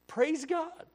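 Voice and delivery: sudden high, loud cry of praise; shouted praise. Real, not acted. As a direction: burst out praising.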